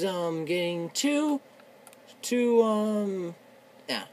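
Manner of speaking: ordinary talk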